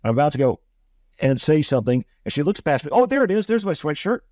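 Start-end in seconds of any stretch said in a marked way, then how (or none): 2.9-4.2 higher, feigned delight at finding it; mock surprise. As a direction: act like you just spotted it, overdone. Housekeeper fakes finding sweatshirt.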